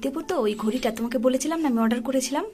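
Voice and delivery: soft conspiratorial whisper, barely voiced; hushed whisper. Real, not acted. Mother whispering the surprise plan to her son, hushed whisper. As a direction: whisper the secret plan so dad can't hear.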